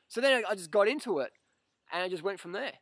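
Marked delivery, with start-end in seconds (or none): none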